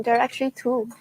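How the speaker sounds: normal talking voice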